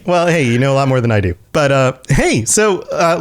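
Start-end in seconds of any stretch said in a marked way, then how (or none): none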